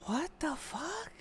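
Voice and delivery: breathless disbelieving whisper; barely voiced, slow and shocked; stunned whisper. Real, not acted. Stunned reaction to the accusation.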